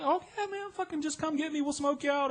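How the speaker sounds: high, easygoing stoner voice; friendly stoner. Retold or imitated. Partygoers welcome the newcomer, high laid-back stoner voice.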